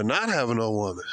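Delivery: ordinary delivery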